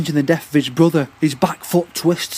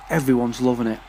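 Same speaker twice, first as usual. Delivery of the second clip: plain reading voice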